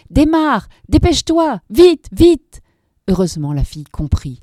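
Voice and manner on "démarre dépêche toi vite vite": high, loud panicked commands; desperate shouted orders; frantic urging. A narrator's reading. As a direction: scream at her to go now. Urging her to flee.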